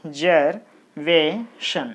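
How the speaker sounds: "Observation" drawn out very slowly, syllable by syllable; slow syllable count. Shown as a demonstration. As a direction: split "Observation" into its beats slowly. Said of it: "So there are four syllables".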